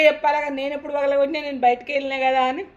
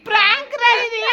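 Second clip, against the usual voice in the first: high, loud laughing voice; laughing prank reveal; 12 semitones higher, 5 dB louder than usual. Real, not acted. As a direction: break it to them through laughter, pitched high. Reveals prank to crying boy, laughing.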